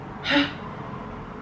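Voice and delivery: long breathy sigh of dismay; heavy sigh. Real, not acted. After the elephant story, a heavy sigh. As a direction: let out a weary sigh.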